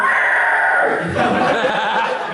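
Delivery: high goblin screech; goblin shriek. Retold or imitated. Goblin on fire in a game, shrieking. Demonstrates goblin screams he recorded.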